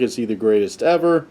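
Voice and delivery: usual speaking voice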